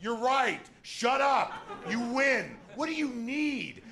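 exasperated yelling. Retold or imitated. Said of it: "I'd be just screaming".

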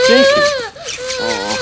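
put-on light up and call the baby's name; aunt thrilled to see the baby; delighted greeting.

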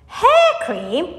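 high, loud, rising question; boy's astonished outcry; incredulous cry. A narrator's reading. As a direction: echo it back in disbelief. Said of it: "cried Mike Teavee".

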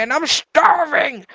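slow, loud, high exclamation; starving outburst. Pretend. Player complaining his character is starving, loud and drawn out. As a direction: swell up loud on the last word.

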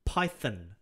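emphatic Python with a falling "thun"; Python ending in "thun". Shown as a demonstration. Correcting the clip's Python to "thun", insistent. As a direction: punch Python, landing low on "thun". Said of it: "See, it says there, thun".

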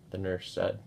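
ordinary delivery, nothing marked

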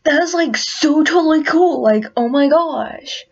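gushing over something cool; excited, cocky pony voice; Rainbow Dash. Acted. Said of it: "I am not sounding like Rainbow Dash".

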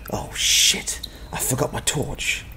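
urgent whispered curse. Acted. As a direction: breathe the words out, no voice. Realizing mistake inside the cave.

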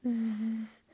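soft, held buzzing "z"; isolated z sound. Shown as a demonstration. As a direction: draw out "z" as a bare sound. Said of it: "I pronounce the S".

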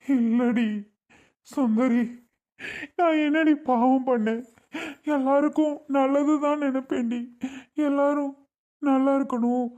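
crying as he pleads his innocence; tearful. Acted. Husband asking his wife what sin he did, crying. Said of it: "ஏன் அழுகுறீங்க?".

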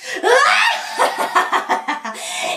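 high, loud witch cackle; long wicked laugh, falling; evil cackle. Acted. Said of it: "lachte vals".

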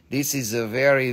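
ordinary talk

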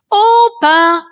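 luide, hoge roep; samen roepen. A narrator's reading. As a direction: roep opa luid door het huis. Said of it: "roepen ze allemaal samen". (kinderen roepen opa allemaal samen)

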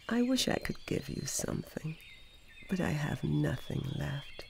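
sorry, breathy whisper. A narrator's reading. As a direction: whisper the apology. Sorrowful tree, nothing left.

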